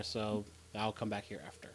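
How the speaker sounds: ordinary talk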